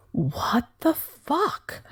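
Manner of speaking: high, slow, disbelieving; bewildered outburst. Retold or imitated. Recalling shame after the call, high disbelieving. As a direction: pitch way up in disbelief.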